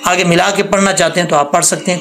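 ordinary talk, nothing marked

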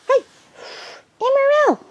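high-pitched call to a dog; high, coaxing name call; calling a puppy. Real, not acted.

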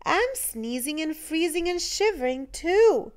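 put-on cold little boy's voice. A narrator's reading. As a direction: say it like a shivering little boy. Little boy speaking.

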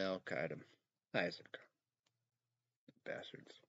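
usual speaking voice

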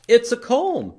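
excited. Acted. Shadow puppet guesses, excited.

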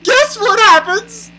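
very high, slow sing-song question; mock suspense. Real, not acted. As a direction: stretch the teaser upward, mockingly. Repeats the teaser, higher.

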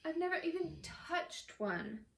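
high-pitched envious lament; envious moan. A narrator's reading. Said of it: "Ron moaned enviously".

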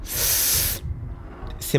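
slow, hushed whisper; scared whisper. Real, not acted. Player hiding from Huggy Wuggy, whispering slowly. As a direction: whisper so the monster won't hear.

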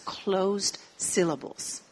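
"closed syllables" said slowly in English; English term. Shown as a demonstration. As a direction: give the English term carefully. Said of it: "los decimos sílabas cerradas". (término en inglés, despacio)

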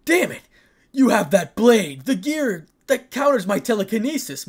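high, loud furious outburst; furious, full-voiced yelling; angry shout. A narrator's reading. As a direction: shout it in rising outrage. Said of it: "Malik shouted angrily".

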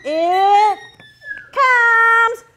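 rising menace into a bite shout; monster attack. Acted. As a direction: build to a loud monster chomp. Monster builds to chomp.

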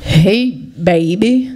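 low, slow southern come-on; thick southern twang. Acted. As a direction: drawl it like a southern man fish. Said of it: "it said in a thick southern twang".

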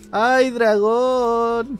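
high, drawn-out whiny wail; mocking teammate whine. Streamer mocking teammates begging for dragon, whiny. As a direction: stretch it like a nagging teammate.

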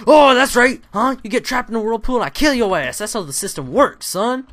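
loud, high gloating taunt; mock the zombie as you kill it.

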